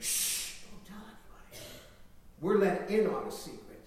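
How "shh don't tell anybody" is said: whisper. Acted. Whispered secret.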